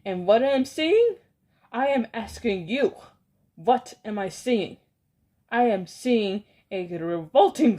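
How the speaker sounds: loud, disgusted villain rant. Acted. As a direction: rage at the revolting children. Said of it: "cried the Grand High Witch".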